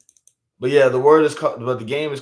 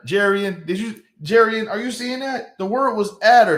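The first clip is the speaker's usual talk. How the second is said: incredulous call; 8 semitones higher than usual. Real, not acted. Calling to friend in disbelief.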